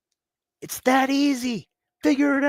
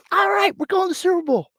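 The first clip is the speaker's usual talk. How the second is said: higher cocky fan voice; mock Cowboys fan; 10 semitones higher than usual. Host mocking Cowboys fans, higher and smug. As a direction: play a smug fan who is sure of the Super Bowl. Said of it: "They're like".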